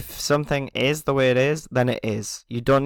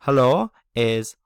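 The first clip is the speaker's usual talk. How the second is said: ordinary delivery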